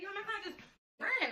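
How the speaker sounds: yelping to friends on the phone that the client ran; shocked disbelief. Retold or imitated. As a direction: squeal it like you can't believe it.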